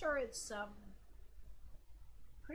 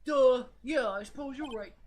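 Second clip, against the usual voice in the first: loud duh then a lightly dopey detective; Gumshoe voice; 10 dB louder than usual. Pretend. Player voicing the detective cheered up, mock dopey. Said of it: "Gumshoe voice".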